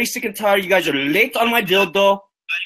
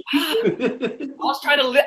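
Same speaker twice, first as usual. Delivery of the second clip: words broken by laughter; laughing; 6 semitones higher, 3 dB quieter, much slower than usual. Real, not acted. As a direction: laugh through the line.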